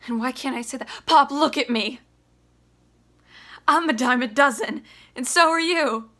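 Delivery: loud, raw, high shouting; furious son. Acted. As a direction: tear into his father. Biff rages at Willie.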